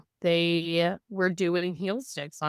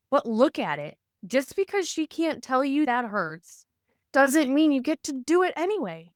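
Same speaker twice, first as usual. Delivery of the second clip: raised pitch, emphatic retold protest; indignant protest; 8 semitones higher than usual. Mother confronting staff over pokes on her baby, indignant protest. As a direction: plead the case indignantly, voice pitched up.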